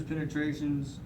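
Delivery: ordinary delivery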